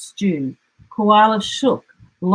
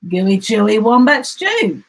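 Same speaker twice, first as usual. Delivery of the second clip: boastful; 3 semitones higher, 5 dB louder than usual. Acted. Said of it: "boasted Dingo".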